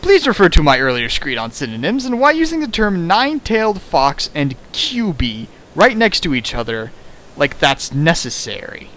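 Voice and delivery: normal talking voice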